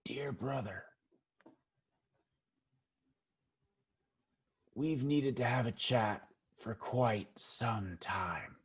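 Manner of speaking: hushed, rasping scarred woman; low, near-whispered menace; raspy, rough voice. Acted. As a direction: rasp it very softly through a damaged throat. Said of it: "her voice is very raspy, very rough".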